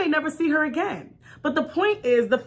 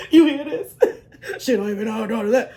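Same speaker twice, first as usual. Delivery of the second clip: mocking laugh; 2 semitones lower than usual. Mocking aside to other moms.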